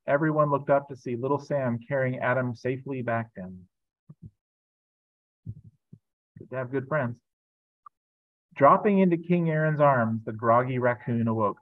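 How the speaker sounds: lively storybook reading voice; reading to children. A narrator's reading. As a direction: read it like a picture book aloud. Pastor reads a children's book.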